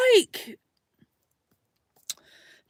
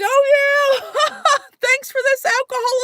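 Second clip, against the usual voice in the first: mock cheerful thanks; 12 semitones higher, slower than usual. Fake gratitude to the stranger.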